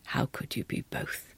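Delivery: low, soft sharp question; low demanding wife. A narrator's reading. The wife questioning the governess, low and quick. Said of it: "she demanded".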